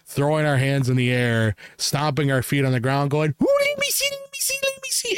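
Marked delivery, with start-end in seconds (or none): 3.4-5.2 piercingly high repeated begging; hammed-up squeal of eagerness; obnoxiously excited. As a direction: make a mock ruckus, squealing to see. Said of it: "very loud and I guess obnoxiously".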